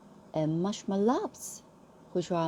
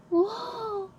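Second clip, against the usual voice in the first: high, thrilled gasp of a word; overjoyed at the sight; squealing lion delight; excited wow; 3 dB louder than usual. Acted. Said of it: "how nervous and excited he was".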